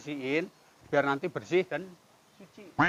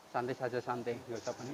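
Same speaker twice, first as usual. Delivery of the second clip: softer and lower, gently calming him down; soothing calm; 5 semitones lower, 10 dB quieter than usual. Real, not acted. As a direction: settle a frightened stranger quietly. Calming a panicked man. (menenangkan dengan lembut)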